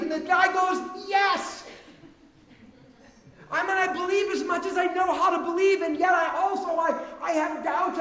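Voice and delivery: loud, high, driving sermon voice; a preacher pressing the point about doubt, loud and high; preaching at full force. Acted. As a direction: preach it hard to the congregation. Preacher riffs on the father.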